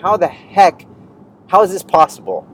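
mock fury at the Jehovah's Witness; half-hammed frustration; retold frustration. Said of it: "I'm pissed".

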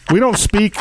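normal talking voice

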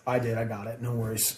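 lightly voiced calm friend; friend's calm reassurance. Friend Eric reassuring the singer, soft and low.

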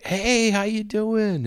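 high, slow, drawn-out welcome; mock friendly host greeting. Host welcoming the narrator to his party, high and slow. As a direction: sing out a big host's hello. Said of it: "He's like".

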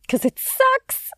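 high, slow whine; whiny. Real, not acted. Whining about being stuck inside.

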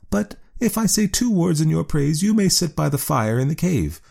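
high woman's voice, pleased. A narrator's reading. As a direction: raise the pitch high as the woman. Woman character voice.

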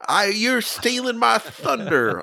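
high, slow Southern drawl as a risen A A Milne; a ghost author complaining in a Southern accent. Acted. Author back from the dead grumbles, Southern accent, high and slow. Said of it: "I don't know why he's Southern".

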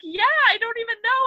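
put-on a boy's high, loud anxious reply; high voiced son. Mother voicing her son's nervous answer, high. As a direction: pitch up into a nervous nine-year-old.

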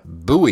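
[name] said as BOO-ee; checking the pronunciation. Shown as a demonstration. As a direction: offer [name] as "BOO-ee" for approval. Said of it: "Am I doing it right?".

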